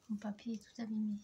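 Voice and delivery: whispered aside to herself. Acted. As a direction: murmur it under her breath. Repeats own remark in a whisper.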